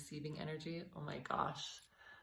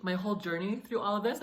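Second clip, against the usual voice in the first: emotional; 4 semitones higher, 8 dB louder than usual. Real, not acted. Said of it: "I'm getting a little emotional".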